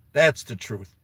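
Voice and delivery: ordinary talk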